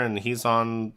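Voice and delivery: usual speaking voice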